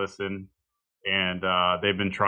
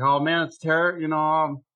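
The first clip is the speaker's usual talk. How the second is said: mock sympathy; 6 semitones higher than usual. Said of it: "I was like".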